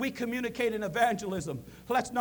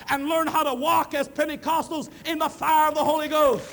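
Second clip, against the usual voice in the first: rising, louder preacher's call to the church; preaching at full force; 4 semitones higher, 5 dB louder than usual. Acted. Preacher urging the congregation to stand firm, rising and loud. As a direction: build it up and proclaim it.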